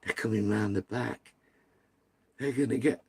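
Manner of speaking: soft, low mock-frightened quote; hushed, as if trying not to be heard; imitating nervous hush. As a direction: imitate someone keeping quiet inside a van. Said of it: "they sit there quiet".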